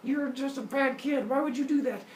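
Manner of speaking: mock angry father. Zeus scolding his small son as he shakes him, mock angry. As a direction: shake the words out like a cross dad. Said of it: "gets even more angry at his son".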